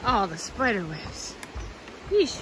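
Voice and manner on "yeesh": high, squeamish recoil; disgusted yeesh. Real, not acted. Hiker walking into spider webs, squeamish high yeesh. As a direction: shudder at the sticky webs.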